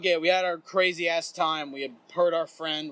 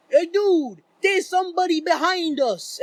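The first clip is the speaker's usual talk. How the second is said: high, loud alarmed friend impression; Vito; 10 semitones higher, 5 dB louder than usual. Friend warning that someone is behind them, high mock-alarmed voice. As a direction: imitate a spooked buddy, pitched way up. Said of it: "And Vito's like".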